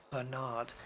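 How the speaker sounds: "Bernard" as ber-NARD; weak first syllable, long NARD in "Bernard"; second-syllable stress. Shown as a demonstration. Host rejecting the States' way, "Bernard" as ber-NARD. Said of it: "it's on the second one".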